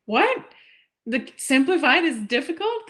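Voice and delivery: stunned "What?"; incredulous. Retold or imitated. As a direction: react as if that's absurd. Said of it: "And I'm like".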